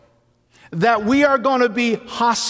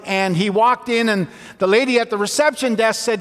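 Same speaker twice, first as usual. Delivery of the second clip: ordinary talk, nothing marked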